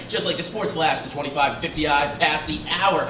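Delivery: ordinary talk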